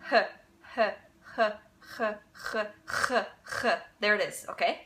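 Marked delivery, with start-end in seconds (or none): 0.1-3.8 h turning into a throaty German ch; breathy h becoming a raspy ch; h sliding into ach sound. Shown as a demonstration. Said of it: "you can try doing that".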